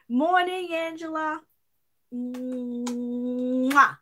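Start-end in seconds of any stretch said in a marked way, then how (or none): 2.1-4.0 loud smacking kiss to the camera; blown kiss. Real, not acted. Host blowing a kiss to a viewer, loud smack. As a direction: blow a big kiss down the lens. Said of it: "I hope you felt that".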